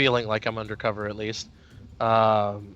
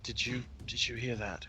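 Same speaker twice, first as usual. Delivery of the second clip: hushed, softer in-character question; whispering; 10 dB quieter than usual. Acted. Asking Astrid if she heard that, whispering. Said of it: "Even if I'm whispering".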